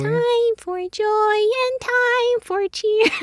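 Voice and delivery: high, slow, squeaky sung line; chipmunk voice. Pretend. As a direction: sing it like a cartoon chipmunk. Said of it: "let's hear what [name]'s chipmunk voice is".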